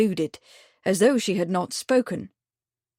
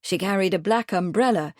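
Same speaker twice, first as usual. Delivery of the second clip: plain reading voice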